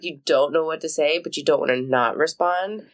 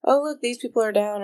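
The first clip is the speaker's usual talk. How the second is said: pitched way up, mock thrilled; mock excited; 8 semitones higher than usual. Friend retelling her own excited reaction. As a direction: act out the gleeful discovery.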